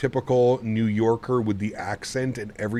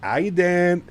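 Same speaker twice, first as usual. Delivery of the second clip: put-on a loose New York accent, higher and pitched up; 8 semitones higher, 4 dB louder than usual. Greeting chat in a rough imitated New York accent. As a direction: try a New York greeting, admittedly not quite right. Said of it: "I can't do the accent properly".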